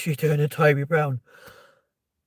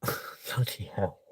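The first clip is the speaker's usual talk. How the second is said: whispered, softer, slow, sighed out in disbelief; breathless whisper; 4 semitones lower, 9 dB quieter, much slower than usual. Real, not acted. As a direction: let it out on a sigh, almost no voice. Overwhelmed, sighing at milestone.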